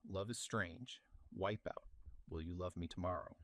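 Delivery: normal talking voice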